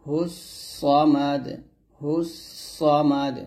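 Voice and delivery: ordinary delivery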